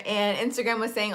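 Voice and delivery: normal talking voice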